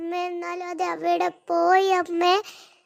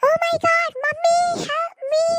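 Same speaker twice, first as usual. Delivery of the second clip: high, drawn-out cry for help; panicked plea; 8 semitones higher, much slower than usual. Acted. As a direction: wail for mommy from the pit. Stuck in the pit, calling mom. (കുഴിയിൽ കുടുങ്ങി അമ്മയെ വിളിച്ച് പേടിയോടെ കരച്ചിൽ)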